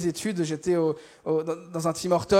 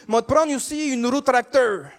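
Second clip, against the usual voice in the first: slow, higher-pitched Québécois order; Québécois accent; 3 semitones higher, 3 dB louder than usual. Acted. Determined customer orders a pastry, thick Québécois accent. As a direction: draw out the order in broad Québécois. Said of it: "je vais faire un accent".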